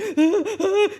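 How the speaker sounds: high, loud mock gasp mixed with a laugh; imitated hyperventilating gasp. Mother imitating her hyperventilating daughter, laughing through a high gasp. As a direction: gasp for air like a hysterical child.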